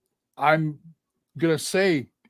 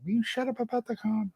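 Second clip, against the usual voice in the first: high, softer mock scolding; high-pitched quoted telling-off; Becky; 11 semitones higher, 8 dB quieter than usual. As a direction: imitate a fed-up nag in a high voice. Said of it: "that sounds like Becky".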